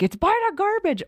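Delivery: high-pitched imitated alarm; high mock-panicked call; retold panic. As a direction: mimic the frantic daughter pointing it out. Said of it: "I can hear the panic in her".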